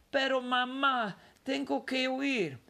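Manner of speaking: boy pleading with his mother, lightly voiced. A narrator's reading. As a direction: beg to stay up a bit longer.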